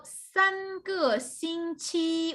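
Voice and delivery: 三个星期 said very slowly, each syllable clear; slow example. Shown as a demonstration. Teacher reads the Chinese example slowly to students. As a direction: read 三个星期 out slowly, word by word.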